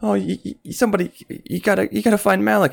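high, frantic, stammering mother; quivering voice. Acted. Mother begging the group, high and quivering. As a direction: beg in a shaking, rising panic. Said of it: "in a very quivering voice".

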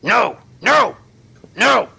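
short, high, loud barks; clipped shouts. Acted. Guard outside warning people off, clipped high shouts. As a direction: bark each word separately, clipped and high. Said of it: "kind of a clipped voice saying".

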